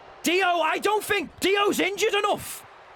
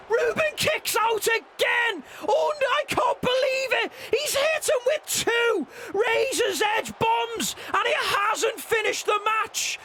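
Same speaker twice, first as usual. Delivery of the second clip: excited commentator shout; 7 semitones higher than usual. Acted. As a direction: yell it like a wrestling announcer. Play-by-play finish, crowd shouting.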